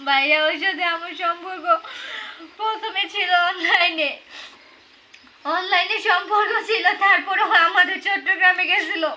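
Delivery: real sobbing between phrases; crying. Real, not acted. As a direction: tell it through tears. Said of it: "কান্না করছেন কেন আপনি". (কাঁদতে কাঁদতে বলা)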